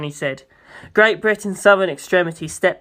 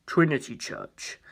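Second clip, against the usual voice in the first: much lower and softer, slow; low slow priest; 3 semitones lower, 9 dB quieter, slower than usual. Acted. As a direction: answer quietly like a grave priest. Pucci answers low.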